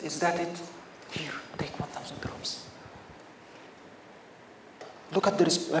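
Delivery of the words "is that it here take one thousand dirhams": very soft, almost whispered retelling; quiet generosity. Retold or imitated. Holy man paying the man who provoked him, hushed. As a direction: drop right down to a hushed offer.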